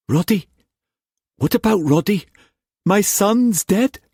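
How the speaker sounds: high, anguished questioning. Acted. Father asking whether his son died, anguished. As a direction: ask in dread, slowing on the last question. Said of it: "Rob said in anguish".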